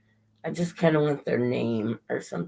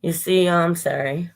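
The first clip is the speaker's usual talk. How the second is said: ordinary delivery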